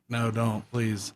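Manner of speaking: low, hushed begging; mock furtive plea behind someone's back; imitated quiet plea. Husband secretly pleading behind his wife.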